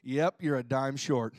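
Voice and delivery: low, slow flat cashier voice; deadpan cashier. Retold or imitated. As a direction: drop the voice for the cashier. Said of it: "the guy goes".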